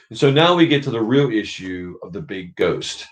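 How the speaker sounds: ordinary talk, nothing marked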